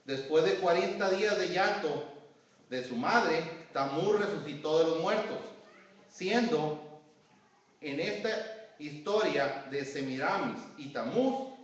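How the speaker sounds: loud, measured sermon reading; declaimed reading with deliberate pauses; reading aloud to congregation. Real, not acted. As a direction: read the notes out emphatically, phrase by phrase. Reads his notes aloud to congregation.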